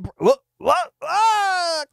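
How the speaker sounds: rising to a high startled yelp; hammed-up shock as the floor drops; mock panicked cry. Brian falls into the trap, mock panicked cry.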